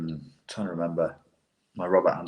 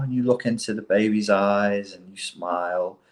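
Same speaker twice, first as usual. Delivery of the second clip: ordinary talk, nothing marked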